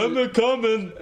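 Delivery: high, badly faked Scottish call; hammed-up Scottish rescuer; Drew McIntyre impression. Co-host as Drew coming to help, mock Scottish. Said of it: "that's what Drew McIntyre".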